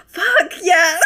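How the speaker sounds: high, loud laughing curse; gasping laugh. Real, not acted. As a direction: burst out laughing. Gasp then laughing.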